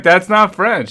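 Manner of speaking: hammed-up strict schoolteacher cutting him off; stern teacher. Teacher scolding a child who can't speak French, mock stern. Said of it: "she's like".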